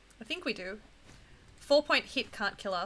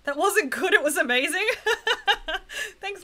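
excited; 9 semitones higher, 7 dB louder than usual. Real, not acted. Excited after good play.

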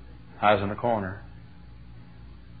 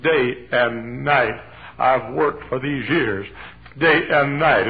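slow, weighty preaching phrase; emphatic preaching; 2 semitones higher, 6 dB louder, much slower than usual. Acted. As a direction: lean hard on day and night. Quoting Paul in sermon.

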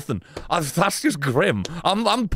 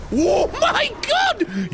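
stunned exclamation; much slower than usual. Real, not acted. Reacting to a game moment.

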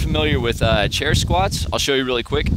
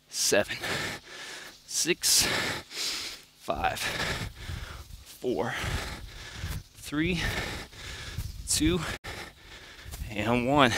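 quiet, strained counting mid push-up; counting workout reps; 8 dB quieter, much slower than usual. Real, not acted. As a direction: count reps under your breath while working. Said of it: "I'm gonna count it out for you too".

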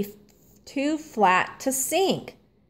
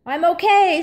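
reassuring call; 8 semitones higher, 5 dB louder, faster than usual. A narrator's reading. Stanley after landing in snowbank.

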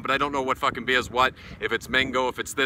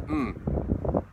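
tasting hum; 12 semitones higher, 4 dB quieter than usual. Real, not acted. First sip of the beer.

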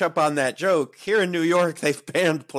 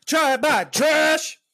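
shouting; 11 semitones higher, slower than usual. Acted. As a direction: build to a big announcer call. Building game-show call.

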